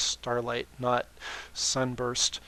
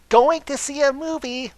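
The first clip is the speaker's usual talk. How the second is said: blurted, high and loud; hasty high-pitched eager blurt; much too quickly; 13 semitones higher, 9 dB louder than usual. Acted. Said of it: "Twilight said much too quickly".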